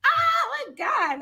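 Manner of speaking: very high, drawn-out mock squeal; acting out starstruck excitement; re-enacted excited squeal. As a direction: squeal it slowly like a thrilled fan. Acting out excited reaction to match.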